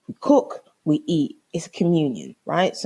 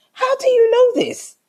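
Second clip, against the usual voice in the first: very high, incredulous challenge; vexed outburst; 4 dB louder than usual. Retold or imitated. Woman challenging boyfriend's reassurance, vexed outburst. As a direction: fire it back way up high. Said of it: "I was so vexed".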